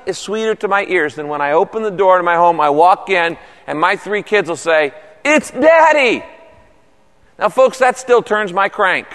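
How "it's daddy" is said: high, drawn-out imitation of excited children; delighted kids' greeting. Kids greeting dad as he walks in the door, gleeful high call.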